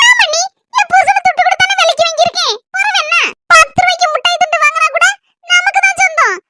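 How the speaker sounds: high-pitched, possessive sister-in-law; high voice staking a claim on her husband; indignant sister-in-law. Acted. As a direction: argue in a high, put-on woman's voice.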